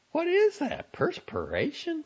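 very high-pitched motherly questioning; high, incredulous mother voice; astonished mother. A narrator's reading. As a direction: ask it high, as a mother taken aback. Mother inspects son's sweaty look.

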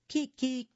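high short crow; quick high rooster call. A narrator's reading. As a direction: crow it out stubbornly. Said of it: "And he did".

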